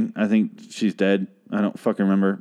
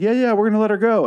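mock fake reassurance; 11 semitones higher, faster than usual. Mocking the women's fake promise.

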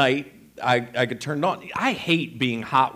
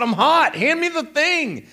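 much higher, loud retold outburst; exasperated demand; 11 semitones higher, 3 dB louder than usual. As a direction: act out a hot, fed-up sleeper. Retold escalating quarrel.

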